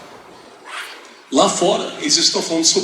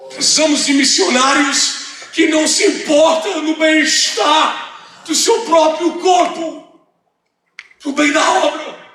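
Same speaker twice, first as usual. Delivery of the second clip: high, slow pulpit proclamation; preaching at full force; 10 semitones higher, slower than usual. Real, not acted. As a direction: drive each phrase home, stretched and raised. Preaches a list of needs.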